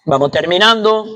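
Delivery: usual speaking voice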